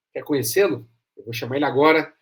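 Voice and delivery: normal talking voice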